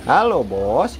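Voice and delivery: "Halo, Bos" swooping up, down and up; sing-song call. Shown as a demonstration. Owner coaching bird to greet the boss, sing-song.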